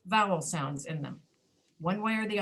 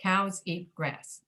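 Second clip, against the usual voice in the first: "Cows eat grass" in three slow, equal beats; weight "Cows", "eat", "grass" the same; even stress; much slower than usual. Shown as a demonstration. Teacher demonstrating the sentence's stress, "Cows eat grass" evenly stressed. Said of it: "stress is pretty even on all three words".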